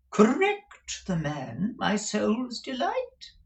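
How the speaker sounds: high, slow, rising echo; incredulous dandy. A narrator's reading. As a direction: repeat it as if shocked.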